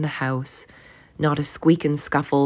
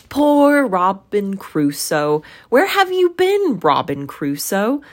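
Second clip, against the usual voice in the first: loud, stretched call leaping up high; parrot's call; 5 semitones higher, 7 dB louder than usual. A narrator's reading. Parrot greets Robin Crusoe home again, squawky call. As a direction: call it like a parrot, swooping up then dropping.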